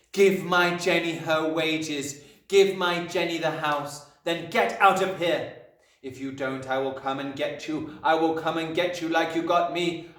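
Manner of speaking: menacing Magnus. A narrator's reading. Chalk message threatening Agatha, forceful and commanding. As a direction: lay down each order firmly.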